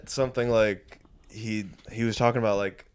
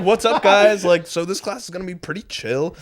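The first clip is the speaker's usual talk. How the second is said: breezy teacher; 7 semitones higher, 5 dB louder than usual. Retold or imitated. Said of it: "He was just like".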